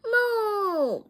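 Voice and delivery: high, loud, long moo; drawn-out loud cow imitation; shouted moo. A narrator's reading. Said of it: "Bean shouts".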